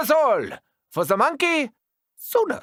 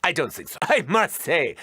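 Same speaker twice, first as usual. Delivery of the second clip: normal talking voice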